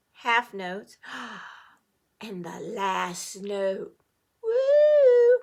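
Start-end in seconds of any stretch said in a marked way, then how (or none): 4.4-5.4 high, loud woo; high excited whoop. Real, not acted. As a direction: burst out in delight. Excited gasp at last note.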